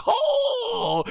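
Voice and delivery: very high, comic squeal of pain; Goku's pained grunt. Retold or imitated. Fan imitating Goma's silly face when hit, a squeaky oh. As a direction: play up the hit Goku takes. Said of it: "you see Goku's like".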